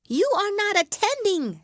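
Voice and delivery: high, loud scolding mouse voice; stern telling-off, pitched far up; severe mouse. A narrator's reading. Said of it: "said the mouse to Alice severely".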